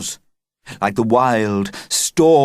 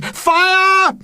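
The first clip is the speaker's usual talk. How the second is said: very high, loud scream; 5 dB louder than usual. Acted. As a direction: scream the alarm. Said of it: "Somebody in the audience screamed".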